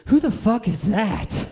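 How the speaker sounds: loud, slow, high confused yell; utterly lost at the picture; baffled outburst. Acted. Dying victim baffled by the child's photo, loud and confused.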